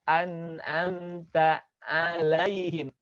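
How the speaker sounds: An'amta alaihim drawn out with a tight ain; slow Quran reading; slow recitation. Shown as a demonstration. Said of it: "tapi an'amta".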